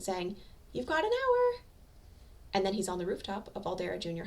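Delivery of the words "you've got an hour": watery and distant. A narrator's reading. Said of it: "watery and distant".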